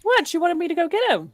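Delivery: high, quick mock protest; protesting guard. Joins in as the scolded guard.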